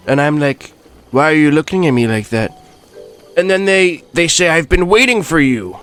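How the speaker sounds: brash rally-speech Trump voice, boastful and rambling; Donald Trump impression. Trump touring Hyrule, boasting to the viewers in his voice. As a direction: play Trump bragging about Hyrule.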